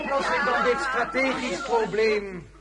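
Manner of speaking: loud, lofty imperial offer; grand pronouncement. Acted. Emperor offers to solve the problem, loud and lofty. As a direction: command the room with it.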